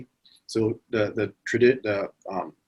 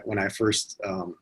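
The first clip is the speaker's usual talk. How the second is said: normal talking voice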